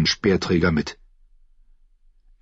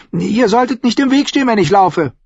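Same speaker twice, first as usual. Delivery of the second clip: put-on high, louder child's voice; high-pitched young boy, reproachful; imperious boy; 14 semitones higher, 4 dB louder than usual. A narrator's reading. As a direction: scold like a high-voiced little lord.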